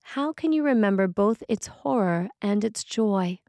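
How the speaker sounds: normal talking voice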